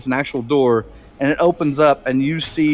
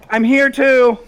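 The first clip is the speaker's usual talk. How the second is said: higher, louder eager sister; another sister; 8 semitones higher, 6 dB louder than usual. Acted. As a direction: pop in brightly, wanting to be counted. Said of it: "the other sister walks out and goes".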